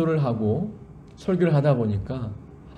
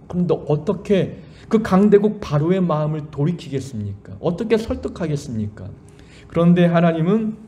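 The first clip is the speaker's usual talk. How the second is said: ordinary talk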